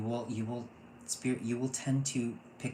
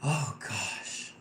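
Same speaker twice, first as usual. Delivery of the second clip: normal talking voice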